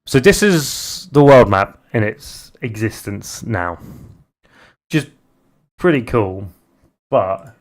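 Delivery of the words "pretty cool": ordinary delivery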